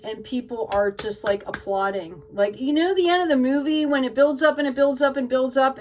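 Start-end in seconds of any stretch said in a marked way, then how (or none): 2.3-5.8 higher, louder, swept up in it; excited build-up. Real, not acted. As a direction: build the excitement like a film climax. Describes a film's swelling climax.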